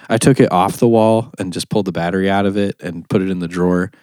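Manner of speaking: normal talking voice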